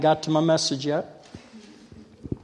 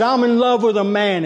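bold proclamation; 7 semitones higher, 5 dB louder than usual. Retold or imitated. Bold declaration entering the bar.

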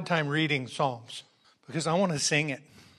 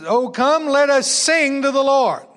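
loud, high, ringing proclamation; lifted high and loud like a herald; proclaiming; 7 semitones higher, 7 dB louder than usual. Acted. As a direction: call it out to the whole church. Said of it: "clearly says".

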